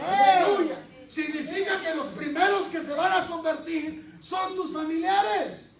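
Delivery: high, loud fervent preaching; preacher shouting at full force. Real, not acted. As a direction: hammer it home to the church.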